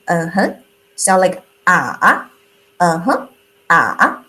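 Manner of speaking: "Uh-huh" dipping low then rising; third tone like uh-huh. Shown as a demonstration. Teacher comparing the third tone to "Uh-huh". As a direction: say "Uh-huh" like agreeing, dip and rise. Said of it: "the same pronunciation".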